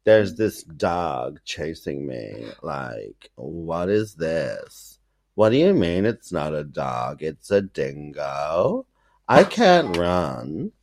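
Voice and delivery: slow, drawled LA vocal fry; valley girl complaint, drawn right out; creaky, low, languid LA accent; Kim Kardashian. Acted. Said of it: "I just channeled Kim Kardashian".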